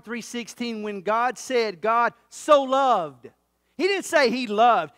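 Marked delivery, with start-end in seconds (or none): 1.8-3.3 so drawn out slowly and weighted; stress on so. Shown as a demonstration. Preacher stressing so in God so loved to his church. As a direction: lean hard on so in God so loved. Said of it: "It emphasizes".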